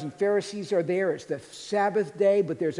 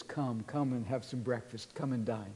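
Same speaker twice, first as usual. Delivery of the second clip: soft, tender invitation; 4 semitones lower, 8 dB quieter than usual. Acted. Jesus invites disciples to breakfast.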